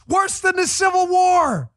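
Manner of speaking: loud, high sing-song shout, stretched slow; mock outrage. Host mocking the Democrats' slogan with a loud sing-song shout. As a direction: belt the slogan out like a sarcastic chant.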